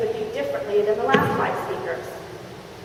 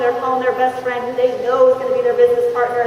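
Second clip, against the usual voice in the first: ordinary delivery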